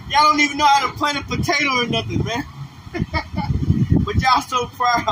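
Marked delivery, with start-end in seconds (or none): 2.5-4.0 a quick airy laugh breaking through; breathy laugh. Real, not acted. Preacher laughing at his own jab at the crowd, breathy laugh. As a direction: laugh under the breath at the joke.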